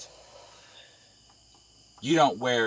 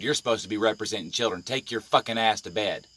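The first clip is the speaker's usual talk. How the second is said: ordinary talk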